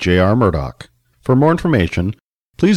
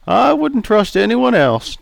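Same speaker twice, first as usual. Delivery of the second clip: put-on raised, jovial old customer; 12 semitones higher, slower than usual. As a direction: play Charlie, teasing.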